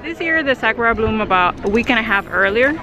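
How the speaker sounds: travel show host. Acted. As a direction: clear tour guide narration. Narrates castle tour voiceover.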